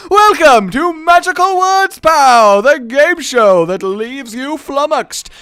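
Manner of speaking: high, very loud game show welcome; game show host. Acted. Host welcoming viewers to Magical Words, game show announcer. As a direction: belt out the show's opening as host. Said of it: "announcement of a game show host".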